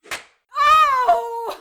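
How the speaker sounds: very high, loud yelp; sharp pained cry. Acted. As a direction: cry out from the blow.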